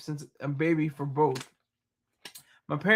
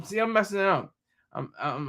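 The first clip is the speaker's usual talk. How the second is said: ordinary talk, nothing marked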